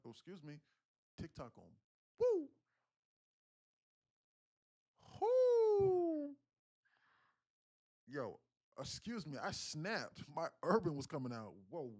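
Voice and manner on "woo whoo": high, sustained "whoo"; big falsetto-high holler; loud high whoop. Real, not acted. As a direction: let out a long piercing whoop. Snapping out of street talk.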